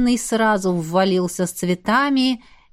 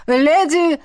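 зов; 6 semitones higher, 4 dB louder than usual. Acted. Зов из-за двери.

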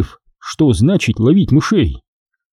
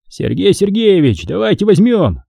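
higher, asking voice; pleading lieutenant; 7 semitones higher than usual. Acted. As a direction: beg the boss. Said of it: "попросил лейтенант Парков".

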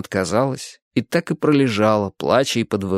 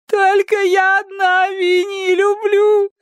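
very high, slow crying wail; sobbing; 4 dB louder, slower than usual. A narrator's reading. Girl crying that nobody loves the cat, sobbing. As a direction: sob it out desperately.

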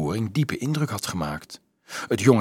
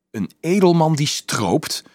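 higher scandalised exclamation; calling out; 7 semitones higher, 4 dB louder than usual. A narrator's reading. Noble child shocked at a poaching nobleman, calling out. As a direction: throw it in as a protest. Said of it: "riep een van de andere mooie kinderen".

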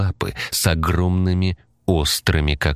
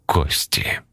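slow eerie whisper. A narrator's reading. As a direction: breathe it out in dread. Narrator whispers the horror.

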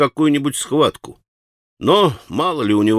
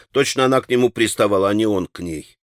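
plain reading voice

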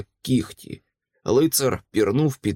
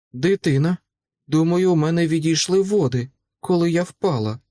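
higher-pitched, worried confession; alarmed woman in labour; 5 semitones higher than usual. Acted. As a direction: break the news in mild alarm. (стривожено, вищим голосом)

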